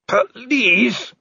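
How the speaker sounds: high, drawn-out beg for mercy; pleading. Acted. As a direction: beg as a wounded creature in a strained high voice. Bleeding werewolf begs for mercy.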